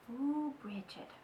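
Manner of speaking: plain reading voice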